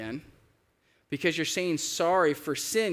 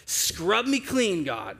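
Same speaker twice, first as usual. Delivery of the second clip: impassioned plea; 5 semitones higher, much slower than usual. Retold or imitated. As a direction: beg God urgently, stretching the words. David's plea paraphrased.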